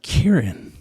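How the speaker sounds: Kieran whispered, then the question shot up high and loud; playful rising disbelief at the Irish name; mock surprise. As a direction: act out the chief pilot catching the Irish name. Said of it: "And he's like".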